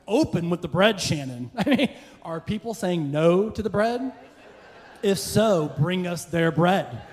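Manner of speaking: loud, high mock indignation; exasperated inner rant. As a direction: scold the server in pretend outrage. Imagined outburst at the server.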